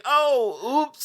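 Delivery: high, airy mock innocence; mock oops. Cohost mimics a reckless friend after a bender, airy mock innocence. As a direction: play the ditzy friend shrugging it off. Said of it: "And it's like".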